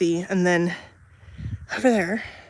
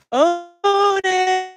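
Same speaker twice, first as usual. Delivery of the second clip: long high loud call across the yard; yelling for the dog; 11 semitones higher, 5 dB louder than usual. Real, not acted. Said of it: "I have to yell loud so he knows".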